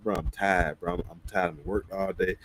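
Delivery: low, soft and drained; barely bothering to answer; exhausted refusal. Retold or imitated. Telling a friend he worked all day, low and drained.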